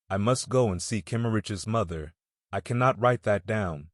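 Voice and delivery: ordinary delivery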